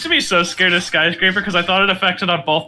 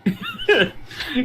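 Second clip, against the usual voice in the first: high laugh; 12 semitones higher, 4 dB quieter than usual. Real, not acted. Laughs at opponent.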